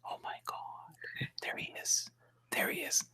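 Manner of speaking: very soft, voiceless mock swoon; awed whisper. Imagined women spotting the hooded man, whispering in awe. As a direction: pretend fans whispering in awe. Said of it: "women all over the world would be going".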